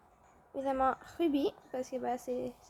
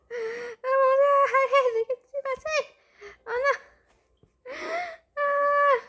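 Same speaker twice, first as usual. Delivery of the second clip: high, loud squealing with a laugh; excited squeal; 10 semitones higher, 8 dB louder, slower than usual. Real, not acted. Reacting to the hatching egg, loud excited squeal. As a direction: shriek with delight.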